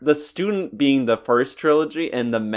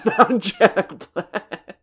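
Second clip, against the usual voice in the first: laughing; 8 semitones higher, much slower than usual. Real, not acted. Laughing emphatic rejection.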